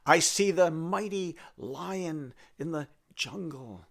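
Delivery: slow, grand storyteller voice; slow, proud recital of visions; storytelling recital. Acted. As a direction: intone it like a fable, slowly.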